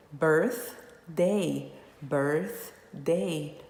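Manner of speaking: birthday split as birth... day; "birthday" in two slow, separate beats; two separate sounds. Shown as a demonstration. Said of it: "Dois sons".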